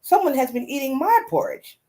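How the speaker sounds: a touch brighter for Mama Bear; middle-sized Mama Bear voice. A narrator's reading. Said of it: "said in her middle-sized voice".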